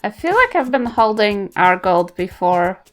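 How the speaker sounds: ordinary delivery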